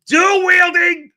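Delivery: drawn-out excited announcer; hyped emphasis. Acted. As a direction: sell the feature like a hype trailer. Hyping a game feature.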